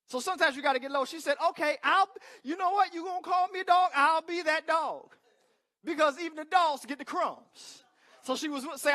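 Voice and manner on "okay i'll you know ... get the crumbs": humble woman. Acted. Woman voiced humbly accepting.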